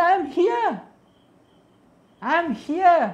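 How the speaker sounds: very high, loud insistent reassurance; Krishna begging Radharani to see him; Krishna pleading. Acted. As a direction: cry out that you are here. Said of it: "Krishna says".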